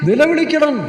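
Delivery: high, loud call to cry out; urgent cry. Real, not acted. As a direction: make the word itself a cry. Preacher urging people to cry out. (ഉയർന്ന ശബ്ദത്തിൽ ആവേശത്തോടെ വിളിച്ചുപറയൽ)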